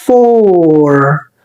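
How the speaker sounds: four with a long drawn vowel before the R; four, long vowel held; slow long vowel. Shown as a demonstration. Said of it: "خلي بالك احنا قلنا".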